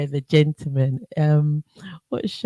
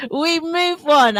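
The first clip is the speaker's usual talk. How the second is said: amused; 13 semitones higher, 3 dB louder, slower than usual. Real, not acted. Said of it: "I giggle to myself".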